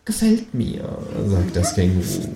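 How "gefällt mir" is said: high, satisfied gefällt mir; kangaroo voice, pleased. A narrator's reading. Känguru approves the plan, high and pleased.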